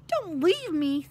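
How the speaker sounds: high, loud, drawn-out plea; anxious pleading. A narrator's reading. Neville scrambles after his friends, pleading anxiously. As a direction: wail it slowly, begging them to stay. Neville begging not to be left.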